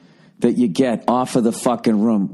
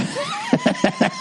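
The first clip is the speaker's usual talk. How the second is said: a real high-pitched burst of laughter. Real, not acted. Laughing high at the friend's joke.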